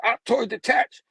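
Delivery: high-pitched villain's order; called-out command. Acted.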